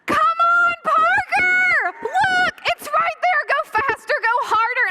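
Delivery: high, urgent all-out cheer; yelling parent. Retold or imitated. Parent at the last spot before the finish, urgent high yelling. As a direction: yell the runner on toward the finish. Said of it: "yelling like the loudest, most embarrassing parent".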